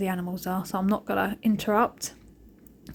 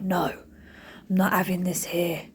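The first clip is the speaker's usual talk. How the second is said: grumpy pig voice; 3 semitones lower, 4 dB quieter, slower than usual. Retold or imitated. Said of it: "boffing the box around like".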